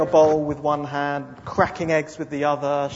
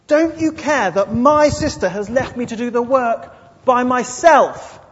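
higher, louder, slowing at the end; demanding complaint; 7 semitones higher, 7 dB louder than usual. Acted. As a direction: demand he take her side. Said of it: "the demanding spirit really comes out".

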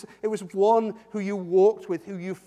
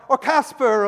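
loud raised call; shouted name; 5 semitones higher, 10 dB louder, slower than usual. Shown as a demonstration. Preacher calls names to startle listeners, shouting. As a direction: fling the name out across the room. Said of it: "if I suddenly shouted out".

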